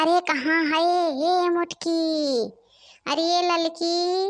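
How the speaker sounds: high, drawn-out calls across the house; mother-in-law hollering for her bahus; calling out. Acted. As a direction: summon the daughters-in-law from afar.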